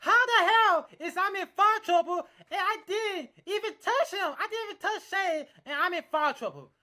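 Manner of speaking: loud, high-pitched mock protest; playfully indignant outburst; mock outrage. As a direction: protest loudly as if wrongly accused. Joking outrage at the foul joke.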